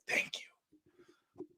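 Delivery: breathy mock whisper of relief; whispered thanks. Imagining his own death, he whispers mock thanks. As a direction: whisper it like a grateful dying man. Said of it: "I'm kinda like".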